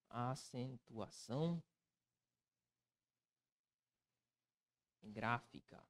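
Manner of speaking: soft, low, drawn-out words with long gaps; slow writing-along. Real, not acted. Teacher writing the title for students, slow and quiet. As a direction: murmur it slowly as you write.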